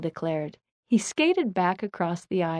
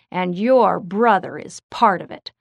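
loud, pointed blame; voiced accusation, pushed out loudly; accusing; 6 dB louder than usual. A narrator's reading. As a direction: lay the blame with firm, loud emphasis. Bill blames Jeremy's brother.

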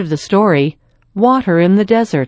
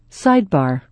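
ordinary delivery, nothing marked

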